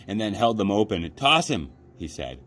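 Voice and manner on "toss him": put-on high, louder boy calling for a throw; eager boy. A narrator's reading. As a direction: call for the toss. Boy urges brother.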